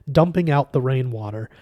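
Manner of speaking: usual speaking voice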